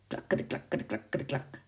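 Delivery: soft, voiceless clip-clop; hoofbeat imitation. A narrator's reading. Narrator imitating the horse's hooves on the road, softly clacking. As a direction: click out galloping hooves under your breath. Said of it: "klonken de hoeven op de weg".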